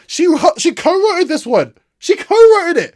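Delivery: exasperated. Real, not acted. Exasperated repeat about co-writer.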